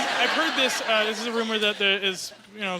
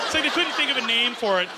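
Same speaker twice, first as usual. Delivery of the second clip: usual speaking voice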